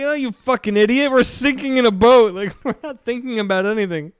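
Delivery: high, loud, laughing outrage; mock exasperation. Sinking sailor berating confused coast guard, mock outraged yell. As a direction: yell at a clueless rescuer in fun.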